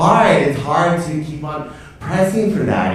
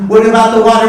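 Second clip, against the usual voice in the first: worried client; 10 semitones higher, 9 dB louder, faster than usual. Acted. Client's question, acted.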